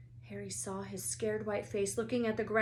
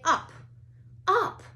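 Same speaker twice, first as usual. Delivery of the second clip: much higher, louder shout; 11 semitones higher, 10 dB louder than usual. A narrator's reading. Students shout at their brooms, high and loud. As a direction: yell the command at the broom. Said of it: "everyone shouted".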